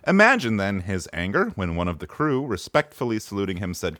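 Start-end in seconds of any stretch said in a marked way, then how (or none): none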